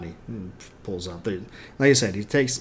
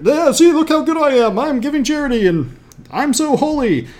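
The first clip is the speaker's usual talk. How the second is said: much higher, louder preening voice; mocking self-righteous hypocrite; 13 semitones higher, 7 dB louder than usual. Mocking hypocrites who flaunt their charity, in a high preening voice. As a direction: show off your holiness in a silly high voice. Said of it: "hypocrites and the Pharisees who go and promote it".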